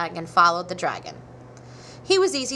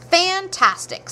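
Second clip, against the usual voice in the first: much higher, louder and drawn out; slow, soaring mock-amazed fantastic; excited praise; 11 semitones higher, 6 dB louder, slower than usual. A narrator's reading. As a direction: gush over him like a starstruck fan. Princess flattering the dragon.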